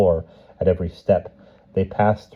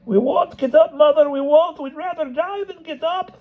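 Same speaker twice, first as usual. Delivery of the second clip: much higher, pleading wail; stubborn cry. Acted. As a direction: Cossacks refusing to rise before the empress. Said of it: "the Cossacks cried".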